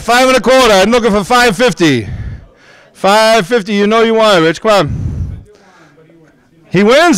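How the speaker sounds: brisk rising auctioneer patter; auctioneer calling bids. Real, not acted. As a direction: drive the bidding up, rallying the room. Live auction bid calling.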